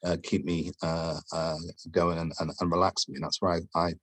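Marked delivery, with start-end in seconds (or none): none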